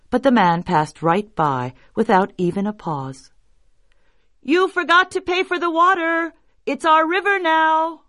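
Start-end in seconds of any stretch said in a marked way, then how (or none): 4.4-8.0 high, ringing group call; indignant cry. Acted. Rich men demanding river money from water carrier, high indignant cry. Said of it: "they cried".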